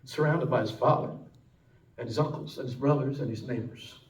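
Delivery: preacher lowering his voice for the sacrifice; hushed solemn sermon close. Acted. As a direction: let it sink in quietly.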